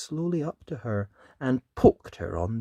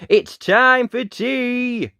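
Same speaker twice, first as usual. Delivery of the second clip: slow, loud, higher call; 12 semitones higher, 10 dB louder, slower than usual. Acted. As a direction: call across the garden. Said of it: "They called him in".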